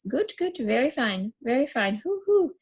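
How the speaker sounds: high squawking goot and hoots; crazed bird chant; bird-boy squawk. Acted. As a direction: squawk and hoot like a mad bird. Billy hopping, flapping like bird.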